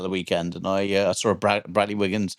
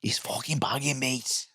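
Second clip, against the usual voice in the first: mock-furtive whisper to a mate; soft, unvoiced aside in character; conspiratorial whisper; 9 dB quieter, slower than usual. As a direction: whisper it like a dodgy tip. Confiding as Craig about drugs.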